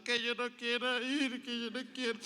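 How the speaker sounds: child refusing to go to kindergarten, mock crying. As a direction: whimper it like a scared kid who won't go. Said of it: "salió llorando".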